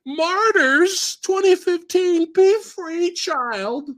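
high, slow hammed-up savior voice; mock noble heroine. Heroine sending the little girl away, high mock-noble voice. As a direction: parody a grand rescuer's blessing.

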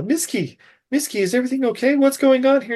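fast, high-pitched concern; worried high voice. Retold or imitated. Sean arrives at crime scene.

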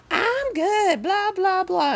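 high, squealing imitation of a tipsy woman; lightly mock the hollering lady; mock drunk lady. Imitating the older woman in the back, high squeal.

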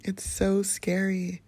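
ordinary delivery

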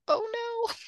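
very high-pitched little lament; retold dismay. Retelling dismay at lame horse.

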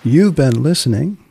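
ordinary delivery

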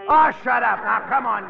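loud, sharp shut-up; fed-up partner barks at him to hush; angry shout. Acted. Telling his partner off.

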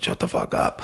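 hushed, fed-up aside; whispered complaint. Acted. Charity listener tells host off, whispered. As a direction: drop into a whisper for the listener's protest.

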